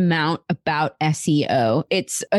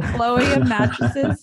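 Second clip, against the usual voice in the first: playful drawn-out mock name; 5 semitones higher, much slower than usual. Joking about getting mattresses.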